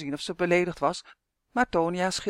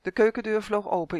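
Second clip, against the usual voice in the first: ordinary delivery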